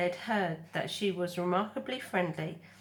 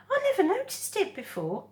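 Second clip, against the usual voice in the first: high gosling voice; 14 semitones higher, 5 dB louder than usual. Acted. Gosling voice in read story.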